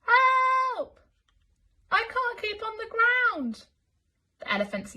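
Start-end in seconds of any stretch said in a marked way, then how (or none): 0.0-3.7 high, loud distant cry; calling for help. Acted. Elmer calling for help from the air. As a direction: shout from far away. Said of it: "heard Elmer's voice calling".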